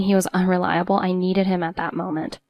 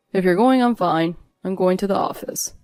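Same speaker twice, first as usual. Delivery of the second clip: spat out. A narrator's reading. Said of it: "as if he was spitting his words out".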